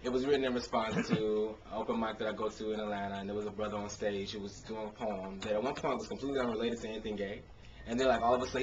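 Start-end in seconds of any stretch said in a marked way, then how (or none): none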